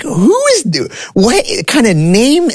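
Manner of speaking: high, squeaky retold disbelief; shocked disbelief. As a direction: voice jumps way up in confused outrage. Teen hears his unknown name.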